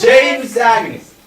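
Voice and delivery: sharp shout of the name; loud sharp voice. Acted. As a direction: bark the name out, exasperated. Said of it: "Why are you shouting at me?".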